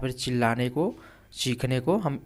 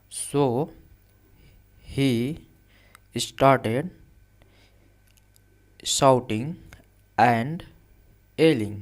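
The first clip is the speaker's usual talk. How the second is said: word by word dictation; 4 dB louder, much slower than usual. Shown as a demonstration. Teacher dictates English sentence word by word.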